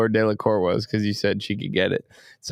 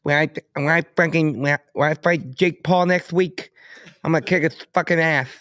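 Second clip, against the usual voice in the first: higher-pitched stammering boxer impression; halting, high mock boxer voice; Mike Tyson; 3 semitones higher than usual. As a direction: imitate a lisping boxer talking trash before a fight. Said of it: "That was my Mike Tyson".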